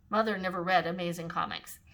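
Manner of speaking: plain reading voice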